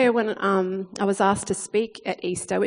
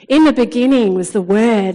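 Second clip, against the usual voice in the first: loud proclamation; 8 dB louder than usual. Acted. Proclaims verse again.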